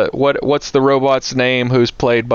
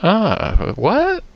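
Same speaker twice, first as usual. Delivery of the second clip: sharp high jump of disbelief; surprised what; 11 semitones higher than usual. Real, not acted. Hearing fireworks were the night before, surprised outburst. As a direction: caught off guard, blurting out shock.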